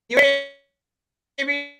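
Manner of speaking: ordinary talk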